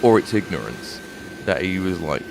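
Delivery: ordinary talk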